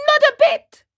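put-on high, loud childish retort; defiant shout. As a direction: fling it back in a tantrum. Puppet boy defies the fairy.

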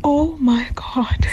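slow, stunned whisper of disbelief; whispered shock at the guest's story. Real, not acted. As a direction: breathe it out, stunned.

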